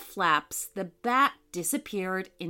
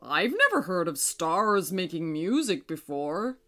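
puzzled moon character. A narrator's reading. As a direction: wonder aloud as the stately moon.

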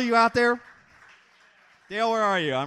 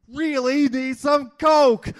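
slow, higher, loud shout of need; desperate shout; 6 semitones higher, 4 dB louder, slower than usual. Acted. Poet shouting about the cokehead who needs coke, slow and loud. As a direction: belt it out like a cokehead begging.

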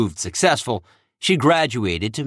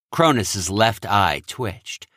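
ordinary delivery

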